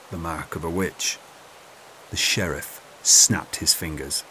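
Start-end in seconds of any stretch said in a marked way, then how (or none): 0.1-1.2 softer, hesitant repeat; nervous. Acted. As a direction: say it timidly, afraid of the lord. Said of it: "cleared his throat nervously".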